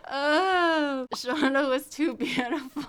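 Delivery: high, teary laughter through words; cracking up at her own story; laughing sob. Real, not acted. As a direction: laugh until it almost breaks. Laughing tears.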